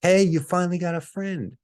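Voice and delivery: high, loud call across the park; girl on a beach towel calling out to him, high and loud; yelled out. Retold or imitated. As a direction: shout it up high and teasing. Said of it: "like, yelled out".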